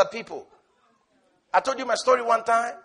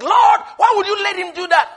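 complaining to the Lord; 8 semitones higher, 7 dB louder than usual. Retold or imitated. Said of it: "you're like".

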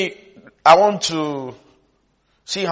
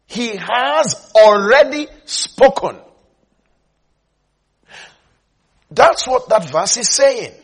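raised, loud, slow proclamation; forceful preaching; 7 semitones higher, 6 dB louder than usual. Real, not acted. Preacher proclaiming the verse to the congregation, forceful. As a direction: proclaim it phrase by phrase, voice lifted.

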